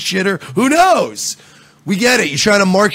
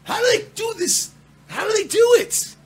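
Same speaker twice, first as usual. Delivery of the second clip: much higher, exasperated shout; frustrated shout; 4 dB quieter than usual. Real, not acted. Host shouts in disbelief at the news coverage. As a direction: cry out at the ceiling in disbelief.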